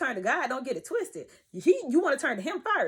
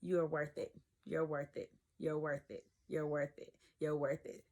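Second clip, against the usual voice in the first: much softer and lower, repeated like a prayer; quiet mantra; 7 semitones lower, 9 dB quieter than usual. Shown as a demonstration. Telling viewers her self-talk, soft lowered mantra. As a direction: murmur it to yourself over and over. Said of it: "I would just say this over and over".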